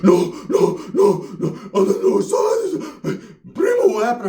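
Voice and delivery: high, slow, weeping whine; crying primo. Retold or imitated. Primo sobbing into the phone to his cousin. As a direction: whimper it like he's just been hurt.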